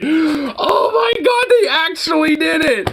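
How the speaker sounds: high, drawn-out shriek of amazement; real squealing astonishment; thrilled disbelief. Real, not acted. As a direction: cry out in delighted shock. Amazed reaction to the trailer scene.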